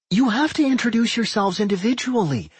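high-pitched interjection; high girl's voice breaking in; interrupting. A narrator's reading. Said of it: "Millie interrupted".

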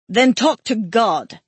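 a slow, curt retort with a touch of bite; mild bark. A narrator's reading. Kotz snapping back at Ismay during the argument, curt and firm. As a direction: cut him off, slow and firm. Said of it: "Kotz barked".

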